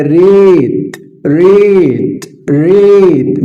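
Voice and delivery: "ريت" with a long, stretched ee sound; lengthened vowel. Shown as a demonstration. Teacher showing the lengthened vowel, "ريت" drawn out. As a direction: hold the vowel of "ريت" long, rising then falling. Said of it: "مديت".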